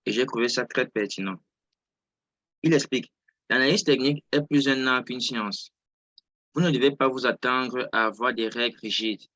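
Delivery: normal talking voice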